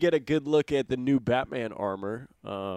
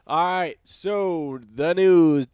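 mock news intro, higher, loud and drawn out; news impression; 6 semitones higher, 10 dB louder, slower than usual. Podcast host jokingly opens the news segment for his co-host, mock news impression. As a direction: put on a big, slow news-show opening. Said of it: "That's my best news, uh, impression".

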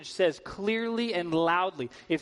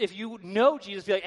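ordinary delivery

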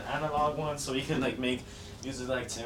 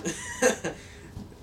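burst of laughter. Real, not acted. Laughing at his own joke.

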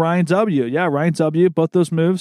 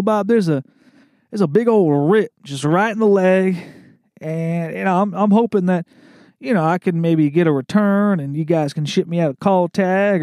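put-on awful Mississippi accent; 4 semitones higher than usual. Mississippi customer asking to return ripped pants, hammy Southern accent. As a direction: draw the words out in a bad Mississippi accent. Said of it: "This is an awful Mississippi accent".

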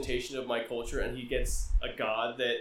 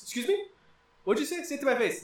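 much higher, indignant challenge; offended Zeus; 10 semitones higher, faster than usual. Retold or imitated. Zeus insulted by Thor calling him coward, indignant. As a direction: dare him to repeat it. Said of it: "He's like".

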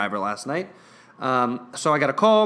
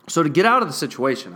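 ordinary talk

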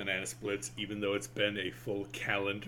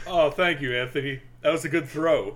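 higher, louder hammed-up thanks; mock cooking host; 5 semitones higher, 8 dB louder than usual. Mock cooking show dialogue.

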